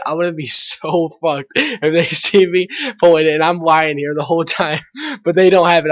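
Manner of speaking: amused, breaking into laughs mid-sentence; laughing while talking. Real, not acted. Teenager recalls nearly getting caught on video, laughing. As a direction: laugh through the confession.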